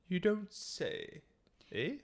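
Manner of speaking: mock posh toff. Continues the posh act.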